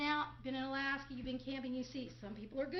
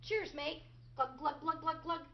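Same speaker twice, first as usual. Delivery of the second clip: smug toast with gulps. Retold or imitated. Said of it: "He's smug".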